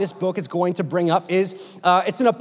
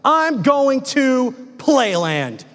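"I'm going to Playland" stretched out slowly, pitched up; emphatic text message; 4 semitones higher, much slower than usual. Acted. Preacher reads out an imagined text, "I'm going to Playland" slow and emphatic. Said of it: "I wanted to emphasize something".

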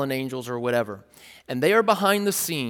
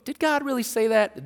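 higher-pitched planting of doubt; musing, raised-pitch query; thought-provoking question; 8 semitones higher than usual. Acted. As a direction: ask it lightly, as food for thought. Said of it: "it's a little thought-provoking".

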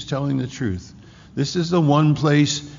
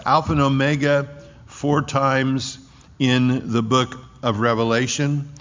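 ordinary talk, nothing marked

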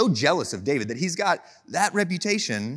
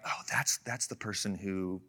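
hushed, breathy gossip whisper; mock whisper; 9 semitones lower, 12 dB quieter than usual. People in a room whispering as he walks in, hushed whisper. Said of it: "they start whispering".

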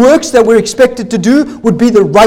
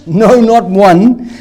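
"no, not one" slow, every word weighted; emphasis; much slower than usual. Shown as a demonstration. Preacher stresses the verse to his congregation, slow and weighty. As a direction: lean hard on "no" and "not one".

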